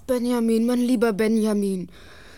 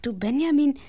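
ordinary talk, nothing marked

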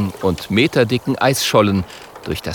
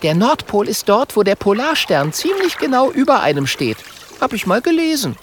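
put-on much higher, know-it-all girl; Mathilda's voice; 12 semitones higher than usual. Mathilda explaining the North Pole to the boys, high and knowing. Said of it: "Da meldet sich Mathilda".

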